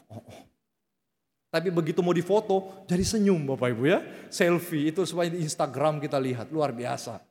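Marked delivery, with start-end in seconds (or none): none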